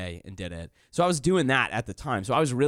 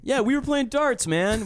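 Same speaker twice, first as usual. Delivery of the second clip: ordinary talk, nothing marked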